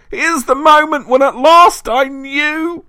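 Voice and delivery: very high, loud, slow shriek of hatred; feverish shriek. A narrator's reading. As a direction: draw it out at fever pitch. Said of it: "reaching a feverish pitch of hatred".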